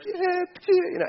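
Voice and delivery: a silly high whine; squeaky mock voice. Preacher voicing the king in a silly squeak.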